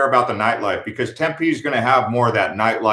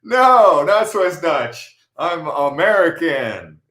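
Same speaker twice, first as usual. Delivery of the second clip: slow emphatic correction; 4 semitones higher, much slower than usual. Retold or imitated. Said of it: "And I go".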